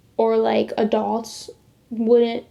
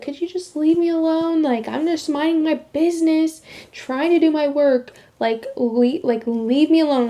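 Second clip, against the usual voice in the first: high, exasperated retort, easing toward the end; blowing up; 5 semitones higher than usual. Retold or imitated. Student telling teacher to leave her alone, retold fed-up outburst. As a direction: imitate a fed-up student, pitched up then settling. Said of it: "I blew up on her".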